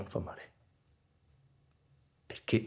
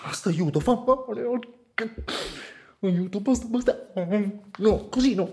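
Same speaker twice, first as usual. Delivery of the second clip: higher, desperate cries for help; anguished higher pleading, close to crying; pained pleading; 9 semitones higher than usual. Acted. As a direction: beg for the pain to stop. Begging the pain to stop.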